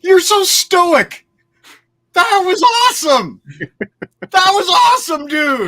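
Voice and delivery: high, loud, drawn-out outburst; excited shouting. Real, not acted. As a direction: shout it in real exasperated delight. Excited outburst at co-host's stoic reaction.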